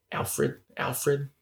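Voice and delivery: soft British accent impression. Shown as a demonstration. Quoting The Prestige to co-host, soft imitated British accent. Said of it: "He uses his normal accent".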